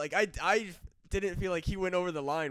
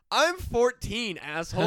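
slow indignant retort; 6 semitones higher, 6 dB louder, slower than usual. Retold or imitated. Retold retort to teacher.

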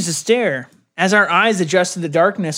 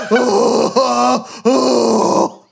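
mock moaning; 4 semitones higher, much slower than usual. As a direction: moan over the top for laughs.

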